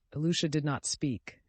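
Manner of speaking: ordinary delivery, nothing marked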